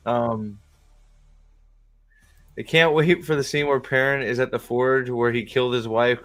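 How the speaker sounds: ordinary delivery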